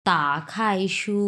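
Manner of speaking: তা খাইশু very slow, each tone clear; slow classroom Mandarin. Shown as a demonstration. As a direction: say তা খাইশু slowly like a teacher. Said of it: "টিচাররা যখন বলবে".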